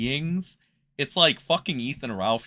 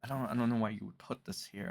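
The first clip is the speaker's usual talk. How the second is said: normal talking voice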